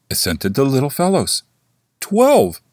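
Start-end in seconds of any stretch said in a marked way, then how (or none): 2.0-2.6 high, louder ringing twelve; bell striking twelve. A narrator's reading. The big bell tolls twelve, high and loud. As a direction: ring out the number. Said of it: "said the bell".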